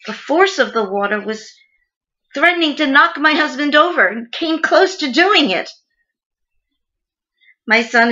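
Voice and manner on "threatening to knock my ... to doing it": higher, urgent, distressed telling; pitched up with alarm. Acted. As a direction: build the danger with a raised voice. Recounting husband nearly swept over.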